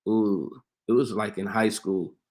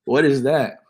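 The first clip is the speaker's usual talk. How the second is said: louder, higher what is that, in disbelief; mock disgust; 4 semitones higher, 6 dB louder than usual. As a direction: act his friend's alarm at the dish. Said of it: "he's like".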